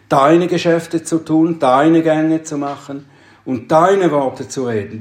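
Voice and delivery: emphatic "deine" at the head of each phrase; stress on DEINE. Shown as a demonstration. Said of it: "Die Betonung ist immer auf deine".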